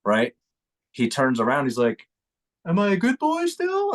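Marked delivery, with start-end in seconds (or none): 2.7-3.9 put-on high childlike plea from an old man; pleading old man. Old man asking reporter if he is still good. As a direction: squeak it out like a lost old man asking approval.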